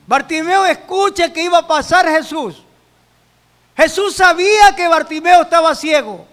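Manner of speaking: loud, emphatic Bible storytelling; forceful preaching. Acted. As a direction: tell the story at full pulpit force.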